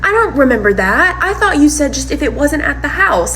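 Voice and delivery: high, mock-innocent voice; playing dumb. Partner claiming not to remember, mock playing dumb. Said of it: "playing dumb".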